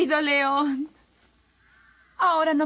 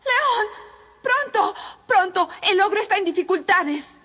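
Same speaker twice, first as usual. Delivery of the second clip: urgent call; 6 semitones higher than usual. Acted. Anja pide ayuda urgente.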